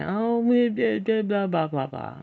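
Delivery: high, loud mock wail of a crying girl; mock whining. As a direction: parody her whining tears. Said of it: "started crying and whining".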